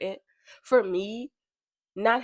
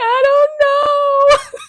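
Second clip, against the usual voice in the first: high, much louder, slow tearful whine; whiny crying kid; 13 dB louder, much slower than usual. Retold or imitated. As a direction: wail it like a confused crybaby child. Retold crying child answering mother.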